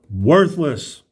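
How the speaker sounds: ordinary delivery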